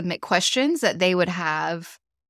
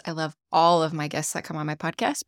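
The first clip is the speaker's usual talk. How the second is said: usual speaking voice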